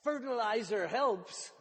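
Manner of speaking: lightly imitated kid's shout; mild retold shout. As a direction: half-shout it like an eager pupil. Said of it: "and he shouts".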